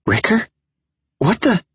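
high shocked gasp of a question. Acted. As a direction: pitch shooting up in shock. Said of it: "a loud gasp".

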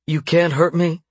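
high-pitched desperate protest. A narrator's reading. Bound captive standing up to her torturer, desperate. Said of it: "she said desperately".

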